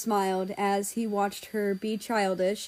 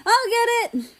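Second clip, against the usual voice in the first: high loud yell from inside; yelled call; 14 semitones higher, 7 dB louder than usual. A narrator's reading. Kids yelling to answer the door. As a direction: shout it toward the door. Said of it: "heard two voices yell".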